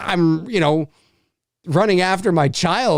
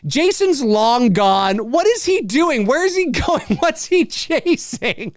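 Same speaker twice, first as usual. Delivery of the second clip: incredulous; 8 semitones higher than usual. Real, not acted. Incredulous rant about sheriff.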